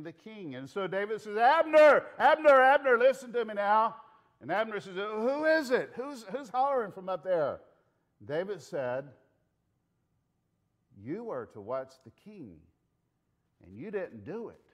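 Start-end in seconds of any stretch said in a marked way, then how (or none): 1.4-4.0 high, loud repeated hail; shouting. Acted. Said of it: "David starts to shout". 4.9-7.6 raised, higher questioning voice; high-pitched demand to know who's calling; startled call back. Acted. Acted guard answers distant shout. 11.0-14.6 soft, measured reprimand; low-key, deliberate scolding; grave rebuke. Acted. Acted David rebukes the guard.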